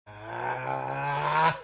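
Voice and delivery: sudden shrieking laugh; loud burst of laughter. Real, not acted. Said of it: "Need this laugh".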